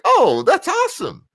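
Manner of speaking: high squeal of delight; mock excited. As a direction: gush like a thrilled kid. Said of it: "And I'm like".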